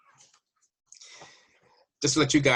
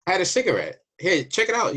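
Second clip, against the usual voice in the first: eager protest; 8 semitones higher, 4 dB louder than usual. Retold or imitated. Defending himself to border guard.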